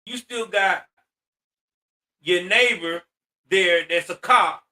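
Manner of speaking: ordinary talk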